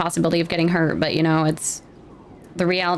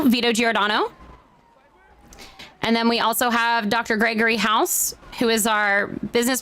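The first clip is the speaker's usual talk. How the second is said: raised, measured public address to the crowd; formal town hall speech; 4 semitones higher than usual. Acted. The mayor welcomes the town hall crowd, formal speech voice. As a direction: open the meeting like a mayor on stage.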